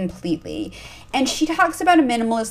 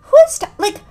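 incredulous outburst; 6 dB louder, faster than usual. Retold or imitated. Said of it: "And I'm like".

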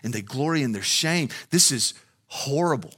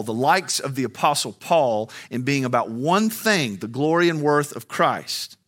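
ordinary delivery

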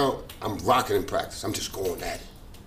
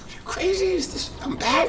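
high, slow, half-crying disbelief; retold bawling; slower than usual. Player just called back, mock tearful and high. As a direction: choke out the words like you can't believe it. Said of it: "So I'm bawling".

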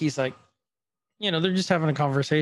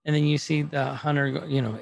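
usual speaking voice

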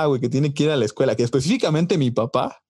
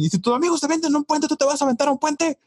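very high-pitched mock scolding; imitating nagging parent; 14 semitones higher than usual. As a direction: put on a parent's shrill-high rhetorical scolding. Acts out a parent's stock scolding.

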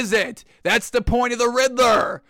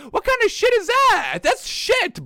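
pitched-up scornful disbelief; incredulous scoff; 12 semitones higher than usual. Real, not acted. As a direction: jeer at it, voice jumping high. Scoffing at regular-season fear.

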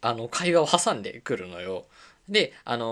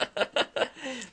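embarrassed laugh; 11 semitones higher, 4 dB quieter than usual. Real, not acted. Laughing at own hopes.

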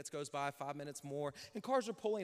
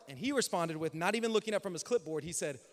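ordinary talk, nothing marked

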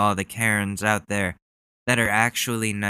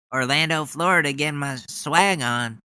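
higher, pumped-up rally-speaker voice; mock hype; 5 semitones higher than usual. Mocking the senator with pumped-up rally hype. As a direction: ham up the swagger like a rally crowd-pleaser. Hyping it like the politician.

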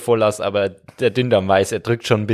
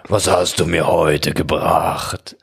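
deep large intestine voice; 5 semitones lower, much slower than usual. Acted.

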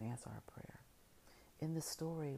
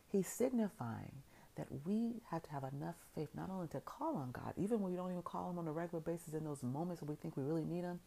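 rising preaching; 5 semitones higher than usual. Acted. Preacher urging the congregation to come out, rising fervor. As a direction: build the fervor toward the close. Preacher builds to the altar call.

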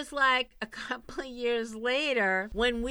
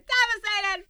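hammed-up devastated; 13 semitones higher, 7 dB louder than usual. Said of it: "I was devastated".

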